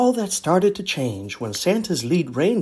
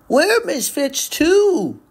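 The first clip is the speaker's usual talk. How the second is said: put-on much higher, slow boyish voice; young Rudolph; 12 semitones higher, 4 dB louder, slower than usual. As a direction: say it shyly like a young reindeer. Rudolph relates to toys.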